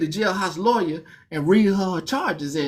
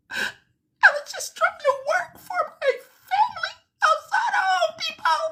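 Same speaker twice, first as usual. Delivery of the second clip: very high squeaky whine; whimpering newcomer. Pretend. Scared newcomer explaining himself, a very high whine. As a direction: plead in a tiny, strained falsetto. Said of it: "And you go".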